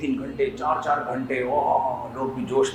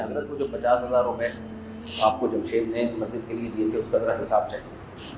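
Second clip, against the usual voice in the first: softer, lower, deferential asking; polite quiet question; 5 semitones lower, 7 dB quieter than usual. Pretend. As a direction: gently ask the holy man about the money. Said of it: "آپ اس سے جا کے پوچھیں".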